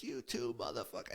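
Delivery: whispered imitation of a furious yell; hushed mock yell. Van owner spotting the cops, whispered mock yell. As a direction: act out yelling, kept very soft. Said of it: "starts yelling and screaming".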